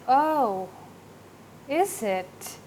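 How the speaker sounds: flat response showing no interest; uninterested. Shown as a demonstration. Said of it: "No interest, right?".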